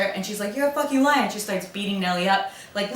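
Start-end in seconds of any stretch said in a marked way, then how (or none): 0.5-1.2 high, loud mock outrage; heated accusation. Housekeeper accused of lying about pinch, heated accusation. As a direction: throw the accusation out, pitched up. Acted quarrel, accusing a liar.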